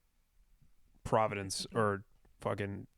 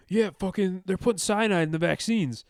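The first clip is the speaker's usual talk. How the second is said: higher, louder mock local guy ranting; conspiracy dude; 8 semitones higher, 7 dB louder than usual. A podcast host mocks a loud local crank, louder and higher.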